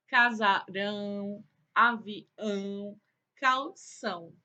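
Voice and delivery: "casarão, avião, calção" with long nasal ão endings; nasal word demonstration. Shown as a demonstration. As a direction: sound out "casarão, avião, calção" slowly, holding each ão. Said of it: "ao pronunciar essas palavras, a gente nota que o som sai".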